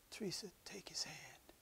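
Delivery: soft, hushed release; tender whisper. Retold or imitated. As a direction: let her go gently, barely voiced. Husband lets her go softly.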